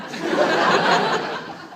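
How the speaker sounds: high, long evil cackle; evil laugh. Acted. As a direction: devilish cackle after the line. Devilish laugh.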